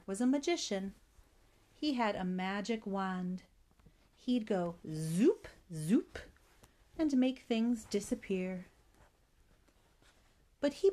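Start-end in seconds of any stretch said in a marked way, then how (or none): 4.9-5.5 buzzy zoop swooping sharply upward; drawn-out rising zoop; magic wand zoop. A narrator's reading. 5.7-6.2 short zoop sliding sharply up; quick rising wand zap; magic wand zoop. A narrator's reading.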